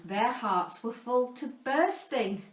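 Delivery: ordinary delivery, nothing marked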